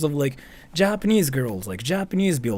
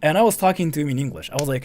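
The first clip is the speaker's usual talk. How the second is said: usual speaking voice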